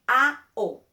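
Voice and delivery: "A-O" loud and pure, no glide; pure Spanish vowels. Shown as a demonstration. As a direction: sing out two clean Spanish vowels. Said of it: "It's not A-O, it's".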